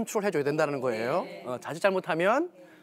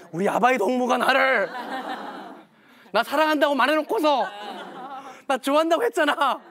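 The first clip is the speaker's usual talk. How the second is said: higher, louder whiny laughing protest; a son whining that his father said he loved him; mock hurt complaint; 5 semitones higher, 4 dB louder than usual. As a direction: play the betrayed son, half laughing.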